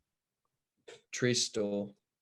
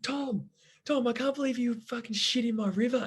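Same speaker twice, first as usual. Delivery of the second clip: much higher voice imitating her; imitated outraged girl; 10 semitones higher than usual. Girl scolding Tom after the swim, imitated outrage. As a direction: put on a high-pitched mock telling-off.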